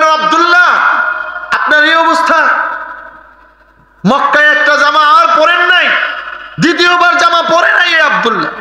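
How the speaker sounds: high, ringing sermon cry; high-pitched pulpit lament; impassioned preaching. Acted. Preacher chants the Prophet's astonished question.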